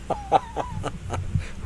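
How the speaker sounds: very high mock squeal of delight; high falsetto tittering laugh; squealing falsetto giggle. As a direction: squeal like a giddy teen.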